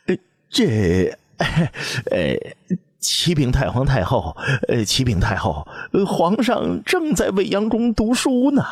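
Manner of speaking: frightened eunuch fumbling an answer to the empress; stammering eunuch. A narrator's reading. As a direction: stammer nervously through excuses.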